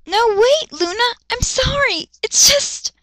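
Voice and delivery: high, flustered apology; embarrassed. Acted. Captain apologising to shy Luna, high flustered voice. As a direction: stammer out a sorry. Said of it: "he was embarrassed now".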